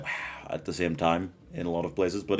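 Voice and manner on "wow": hushed, awed "wow"; a very soft gasp of wonder; wide-eyed wow. Acted. Describing wide-eyed Jack, softly awed. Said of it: "kind of the wide-eyed".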